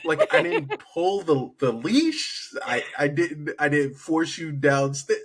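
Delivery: high, laughing mock exasperation; exasperated protest. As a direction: plead your case to a baffling pet, voice climbing. Mock scolding of scared cat.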